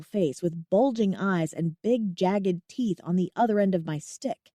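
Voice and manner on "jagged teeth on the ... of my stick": usual speaking voice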